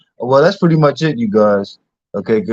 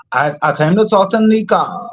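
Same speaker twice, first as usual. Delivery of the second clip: higher-pitched mimicry of his affected voice; imitating his put-on voice; 6 semitones higher than usual. Host mimicking the man from the clip, put-on higher voice. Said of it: "that voice that he did".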